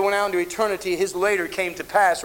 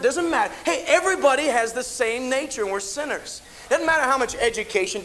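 raised, pressing sermon voice; preaching with fervour; 5 semitones higher than usual. Acted. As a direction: drive the point home to the congregation. Preaching at full force.

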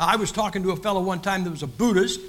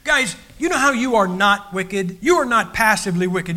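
normal talking voice

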